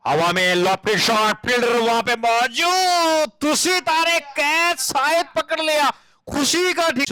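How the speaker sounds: high, slow tense catch call; excited cricket commentary. Acted. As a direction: rise at the possible catch.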